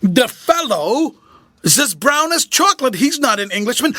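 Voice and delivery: rising panic in a trembling voice; mild quivering fright; quivering alarm. A narrator's reading. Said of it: "same quivering voice as before".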